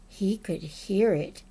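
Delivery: plain reading voice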